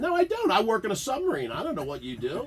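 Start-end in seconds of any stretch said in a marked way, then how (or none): 0.0-0.5 mock indignant. Real, not acted. Joking reply to correction.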